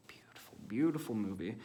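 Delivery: breathy whisper of adoration, no voice; whispered gushing. Real, not acted. Reviewer whispering love for a film. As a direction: hush it like a precious secret.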